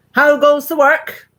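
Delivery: loud slow bossy call; farmer calling out. Acted. Lazy farmer calling from his bed, loud and slow. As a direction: bellow it out like a lazy boss. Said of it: "called the farmer".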